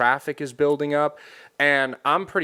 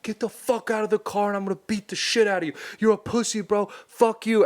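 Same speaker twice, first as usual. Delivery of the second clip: higher, fast mock yell at the driver; retold angry yell; 8 semitones higher, faster than usual. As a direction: threaten him through the window. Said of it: "banging on his window".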